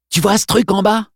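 high, fast gloating thug; mustache twirling villain. Acted. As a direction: rush the threat, then sneer. Congdon taunting Dale.

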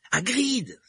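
high, loud cry of a name; shouting. A narrator's reading. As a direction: call out sharply for help. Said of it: "s'écria Harry".

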